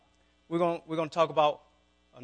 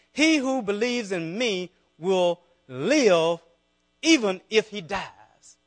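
scripture reading; 3 semitones higher, 9 dB louder, slower than usual. Acted. Slow emphatic scripture reading.

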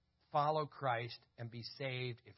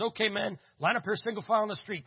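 loud, higher retold command to the gang; calling his men; 7 semitones higher, 8 dB louder than usual. Biker boss loudly lining up his men. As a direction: bark the order down the street like a club boss.

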